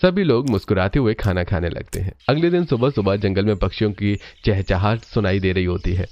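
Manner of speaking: ordinary delivery, nothing marked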